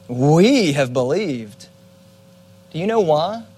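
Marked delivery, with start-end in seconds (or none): 0.1-1.7 slow, leaning on "We"; stressed we. Retold or imitated. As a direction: drag out "We have believed" with pride. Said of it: "Jesus is reminding them".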